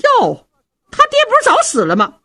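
high, quick surprised retort. Acted. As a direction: exclaim shrilly as the startled wife. Wife retorts that father is dead. (尖声惊讶地反问)

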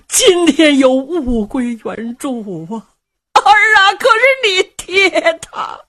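put-on a crying old mother's cry, rising high on 儿啊; old mother weeping. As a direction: voice breaking into tears as she speaks. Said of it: "老太太已然泣不成声了". (老母亲哭诉，声音颤抖)

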